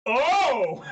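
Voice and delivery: sudden high laughing shout; excited shout. Real, not acted. Streamer yelling at his opponent's huge play. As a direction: burst out at the big play.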